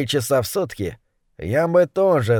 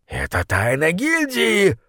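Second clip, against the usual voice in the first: barked; 4 semitones higher, slower than usual. Acted. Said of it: "рявкнул на него крысолов номер один".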